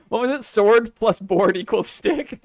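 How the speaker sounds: real laughter around the words; laughing. Real, not acted. Streamer recalling the sword and board joke, laughing. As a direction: recall the joke while cracking up, slowing on the punchline.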